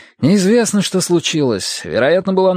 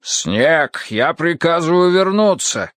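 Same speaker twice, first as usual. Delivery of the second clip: firm, mildly raised command; shouted order; 5 semitones higher, slower than usual. A narrator's reading. Kar orders the captain back over radio, in a restrained shout. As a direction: order him back, voice raised only slightly. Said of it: "крикнул Кар".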